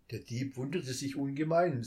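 ordinary delivery

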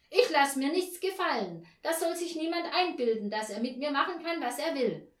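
high, loud angry tirade; indignant interruption. A narrator's reading. Neighbour talks over the landlady, indignant interruption. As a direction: cut in loudly, refusing to be pushed around. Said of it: "rief Frau Janka dazwischen".

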